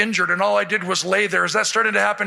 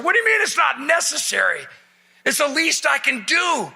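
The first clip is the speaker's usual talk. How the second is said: raised pitch, protesting; mock indignant insistence; 5 semitones higher than usual. Passenger insists a soldier take his seat, mock indignant. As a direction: insist in mock outrage, stretching the last words.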